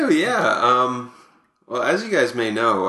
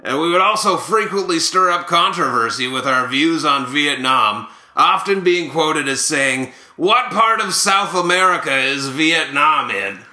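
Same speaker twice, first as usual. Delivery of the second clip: hammed-up ballplayer raconteur, lifted pitch; animated, put-on storytelling voice; Buck Martinez. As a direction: spin the tall tale as a comic ex-catcher. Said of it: "here to present these is Buck Martinez".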